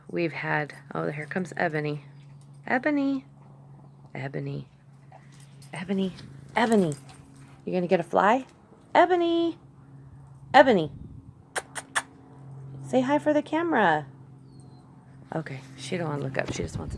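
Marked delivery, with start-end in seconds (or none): 2.7-10.9 calling the dog. Real, not acted. Owner calling her dog to come. 12.9-14.1 high sing-song coaxing; coaxing the dog. Real, not acted. Owner coaxing her dog to look at the camera.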